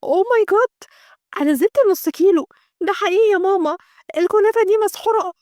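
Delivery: put-on high-pitched, dramatic young woman's outcry; mild scream of alarm. A narrator's reading. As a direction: cry out in alarm without really shouting. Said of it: "وفجأة صرخت".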